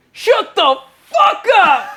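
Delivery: slow, high, loud laughing yell; laughing shout. Retold or imitated. Soldiers yelling at the joker, laughing loud. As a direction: shout it while cracking up. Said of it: "everybody goes".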